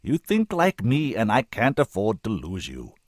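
loud, commanding oratory; full-power voice. A narrator's reading. Senator urges the woman not to give up, full-powered. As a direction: press each point home with full force. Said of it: "using the full power of his voice now".

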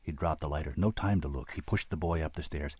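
plain reading voice

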